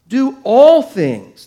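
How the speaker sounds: slow, loud "Do all things" with ALL hit hard; preacher hammering home "all"; stress on all. Shown as a demonstration. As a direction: lean on "all", drawn out. Preacher repeats key phrase.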